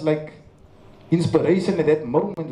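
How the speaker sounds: usual speaking voice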